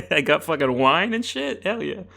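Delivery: ordinary delivery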